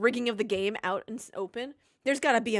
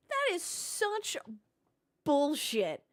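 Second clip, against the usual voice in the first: slow outraged drawl; 5 semitones higher, much slower than usual. Real, not acted. Outraged streamer reaction.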